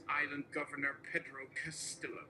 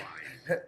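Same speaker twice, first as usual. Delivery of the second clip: a quick real laugh breaking through; short laugh; 5 dB louder than usual. Real, not acted. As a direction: laugh out loud at the text. Laughs mid-speech reading.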